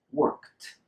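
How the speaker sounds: "worked" as workt, no E at all; D turned into T. Shown as a demonstration. Teacher isolates the key sound for learners, ending in T. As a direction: release "worked" on a clean KT. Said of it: "Eliminate any E".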